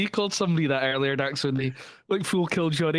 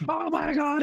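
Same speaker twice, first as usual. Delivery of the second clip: put-on high, stunned little-kid cry; astonished kid squeal; kid's shocked gasp; 13 semitones higher than usual. Kid freaks out at Cena.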